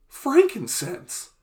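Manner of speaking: Frankincense drawn out slowly, high, falling; drawn-out high word. Acted. Savoring the word.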